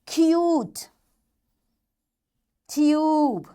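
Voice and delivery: "cute" and "tube" with a long drawn-out U; long U sound. Shown as a demonstration. Teacher practises long vowel words with pupils, U stretched.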